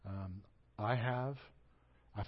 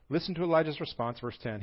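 normal talking voice

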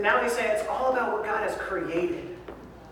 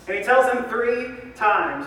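normal talking voice

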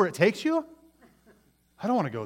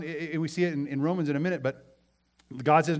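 ordinary talk, nothing marked

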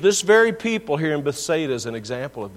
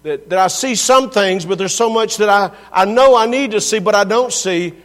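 raised, higher preaching voice; preaching at full force; 4 semitones higher, 7 dB louder than usual. Acted. Pastor pressing the congregation, loud preaching. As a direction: press the point hard.

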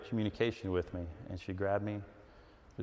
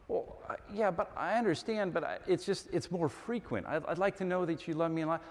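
soft, high, halting voice; acted wife tiptoeing through a request; timidly plead, stumbling over words; wife's hesitant plea; 5 semitones higher, 4 dB quieter than usual. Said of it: "this is your wife, husbands, to you".

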